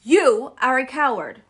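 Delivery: loud, stern rebuke; scolding. Acted. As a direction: tell him off firmly. Dorothy scolds the lion.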